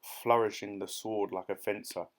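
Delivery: usual speaking voice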